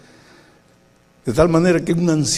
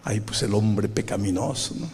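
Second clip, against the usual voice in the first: ordinary talk, nothing marked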